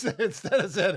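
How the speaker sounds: ordinary delivery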